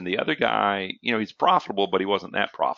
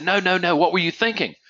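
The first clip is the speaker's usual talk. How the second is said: higher, louder mock insistence; insistent reporters; 8 semitones higher, 3 dB louder than usual. Reporters refusing the athlete's answer, insistent and raised. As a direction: push back, not taking that answer. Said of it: "they said".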